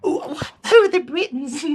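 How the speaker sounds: put-on high, slow puzzled old woman; old lady. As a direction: drag out the question like a dotty crone. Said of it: "here comes the old lady".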